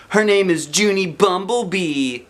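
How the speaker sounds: high, slow teasing announcement; teasing girl. A narrator's reading. Lucille teasing Junie B in line, mocking. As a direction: sing out the silly name to mock. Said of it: "said Lucille".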